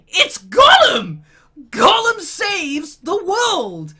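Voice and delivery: high, loud, slow proclamation; exasperated, drawn-out ranting yell; emphatic shout. Real, not acted. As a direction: shout each word to drive the point home. Ranting, proclaims the true hero.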